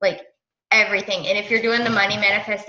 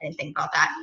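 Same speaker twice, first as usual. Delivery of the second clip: quick quiet aside; 6 semitones lower, 6 dB quieter, faster than usual. Real, not acted. Host apologizing to the team for swearing, quick and quiet. Aside about kids listening.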